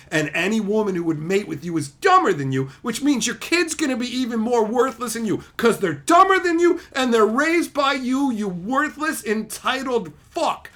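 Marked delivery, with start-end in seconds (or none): 2.8-10.7 pitched-up ranting insults; angry tirade. Real, not acted. Streamer tearing into an entitled dad, angry rant. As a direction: build to a peak, then drag out the insult.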